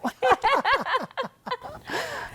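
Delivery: long, high peal of laughter; high, rolling laugh. Real, not acted. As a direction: laugh heartily at your own joke. Laughs after joking about retiring.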